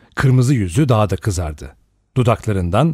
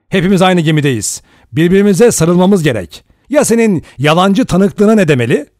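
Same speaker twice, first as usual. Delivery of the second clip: building louder and higher; angry voice; 7 semitones higher, 6 dB louder than usual. A narrator's reading. Lombard angrily turns the charge on Blor, building. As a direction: press the attack, rising heat. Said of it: "kızgın bir sesle".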